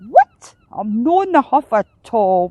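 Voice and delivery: loud squawked "What?", then sulky protest; indignant whinge. A narrator's reading. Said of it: "whinged Heron".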